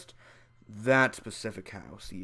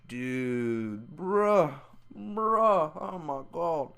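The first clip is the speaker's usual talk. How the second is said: ordinary talk, nothing marked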